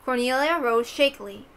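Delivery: ordinary delivery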